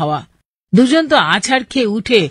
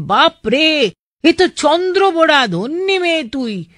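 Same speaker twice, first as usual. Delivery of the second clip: higher-pitched alarmed exclamation, read out; panicked father's cry; 7 semitones higher than usual. Retold or imitated. Father spots a viper in his daughter's bag, mock panicked cry. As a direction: voice the father's horrified outcry, pitched up. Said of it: "আতঙ্কে বাবা চিৎকার করে বলেন".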